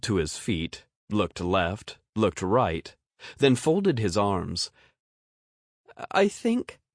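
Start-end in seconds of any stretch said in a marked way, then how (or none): 6.1-6.8 put-on unsure little boy. A narrator's reading.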